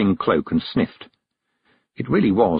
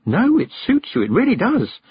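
put-on bright, high-pitched cheerful girl; eager, upbeat encouragement; 13 semitones higher than usual. A narrator's reading. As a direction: reassure her cheerfully, pitch lifted well up. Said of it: "said Tiffany cheerfully".

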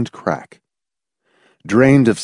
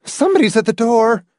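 high, rushed announcement; frantic, quick wife's voice; 12 semitones higher, faster than usual. A narrator's reading. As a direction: blurt it frantically. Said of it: "Marcia said frantically".

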